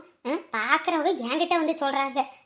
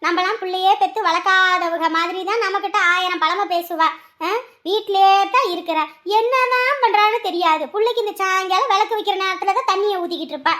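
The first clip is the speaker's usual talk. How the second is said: raised, high-pitched tirade; loud, higher griping; scolding complaint; 4 semitones higher, 4 dB louder than usual. Acted. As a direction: rail about the daughter-in-law. Mother-in-law complains in acted quarrel.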